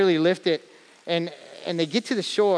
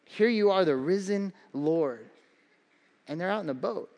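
usual speaking voice

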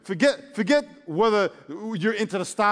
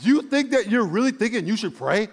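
ordinary talk, nothing marked

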